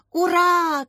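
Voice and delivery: loud, high hurrah; triumphant shout. A narrator's reading. Boy whose team is leading, triumphant shout. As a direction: cheer your own victory. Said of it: "кричал Думцев".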